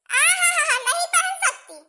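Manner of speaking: high, mocking laugh before the jab. Mother-in-law mocks her daughter-in-law's complaint, laughing. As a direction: laugh her question down with scorn.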